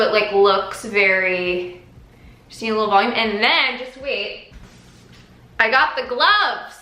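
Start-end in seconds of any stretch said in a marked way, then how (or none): 5.6-6.8 chewing gum while talking; mouth full. Real, not acted. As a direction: talk around a wad of gum. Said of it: "Sorry, I have gum in my mouth".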